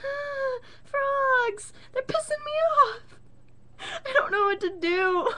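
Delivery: high whiny fake sobbing; mock crying whine. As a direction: whimper like a kid near tears. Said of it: "And I'm like".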